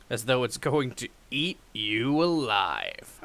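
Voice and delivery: slow, spooky narrator; ominous narration. Acted. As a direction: let it hang with dread.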